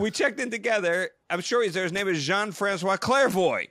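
insistent protest. Retold or imitated. Guest assures receptionist his colleague is there, insistent. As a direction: plead your case to an unhelpful clerk.

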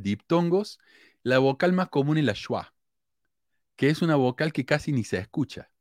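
usual speaking voice

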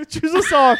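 laughing shout, high and loud. Real, not acted. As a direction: burst out laughing on it. Laughing while explaining the game.

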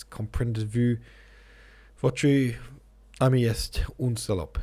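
mild snarl in French; contained snarl, flung out in pieces. A narrator's reading. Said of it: "he snarled at me".

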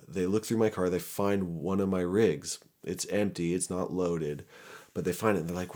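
usual speaking voice

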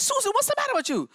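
mother's worried question. Retold or imitated.

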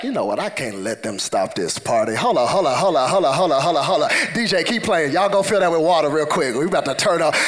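low-pitched, playful party hype man; Jesus/party hype. Acted. Preacher as Jesus telling DJ to keep playing, playful hype. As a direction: take charge of the party, voice dropped.

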